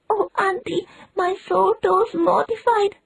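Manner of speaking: put-on milk every word like a dying child.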